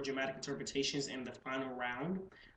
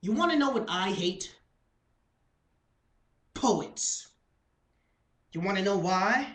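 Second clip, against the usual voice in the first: louder, higher, pointed questions with long pauses; theatrical slam-poetry delivery; spoken-word performance; 6 semitones higher, 9 dB louder than usual. Acted. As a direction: pose each question to the crowd, loudly.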